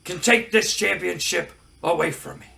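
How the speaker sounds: loud, brash wrestler bragging; a touch of Hulk Hogan swagger; Hogan promo voice. Retold or imitated. Wrestler boasting into the camera, brash promo voice.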